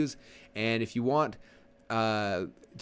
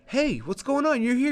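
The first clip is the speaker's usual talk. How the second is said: excited greeting; 13 semitones higher, 3 dB louder, slower than usual. Retold or imitated. Author greets buyer in store.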